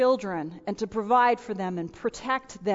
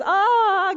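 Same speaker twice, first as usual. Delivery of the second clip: mock scream; 7 semitones higher, 6 dB louder than usual.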